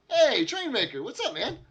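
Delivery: excited greeting. Real, not acted. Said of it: "streamer greets chat".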